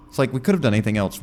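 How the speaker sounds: ordinary talk, nothing marked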